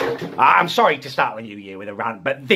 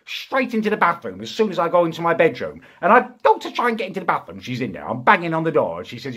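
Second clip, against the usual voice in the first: ordinary talk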